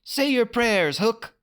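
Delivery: put-on high boyish taunt; cheeky hero's threat; Peter taunting. A narrator's reading. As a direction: dare him to fight. Peter challenging Hook.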